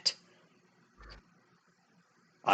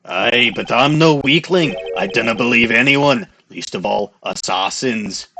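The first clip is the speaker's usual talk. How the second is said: put-on Scottish accent. Voicing Scottish character Scotchworth.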